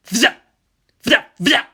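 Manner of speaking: sharp high zap noises, loud; zapping weapon sound. Acted. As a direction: spit out short energy-blast sounds. Positron cannon sound effects.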